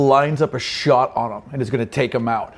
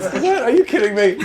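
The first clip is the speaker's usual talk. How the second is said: high, incredulous laugh; can't believe what he's hearing; laughing, shocked GM; laughing disbelief; 9 semitones higher than usual. Real, not acted.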